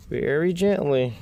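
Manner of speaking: slow emphatic caution on "very gently"; stress on very gently. Real, not acted. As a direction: stretch "very" up high and slow, then ease down on "gently". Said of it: "When I say very gently".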